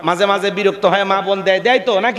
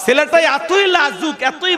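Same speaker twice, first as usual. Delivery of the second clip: fervent shout; 7 semitones higher, slower than usual. Real, not acted. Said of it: "preacher shouts praise".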